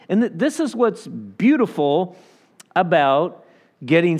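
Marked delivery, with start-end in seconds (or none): none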